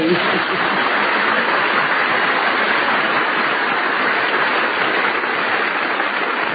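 breathy long laugh. Real, not acted. [name] laughs as Judy arrives.

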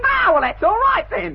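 cheeky shout. Acted. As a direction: shout it smugly.

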